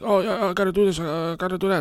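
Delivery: careless chaotic people. Retold or imitated. Mocking the chaotic people who never plan. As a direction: toss it off like you don't care. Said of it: "these motherfuckers are like".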